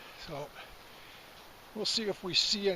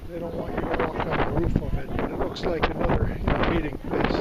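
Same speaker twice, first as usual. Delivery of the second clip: loud; 9 dB louder than usual. Real, not acted. Loud over wind on cliff.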